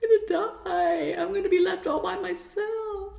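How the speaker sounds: put-on high, loud, frightened child's voice; scared little kid. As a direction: voice a panicking little child begging not to be left. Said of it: "It's that little child that goes".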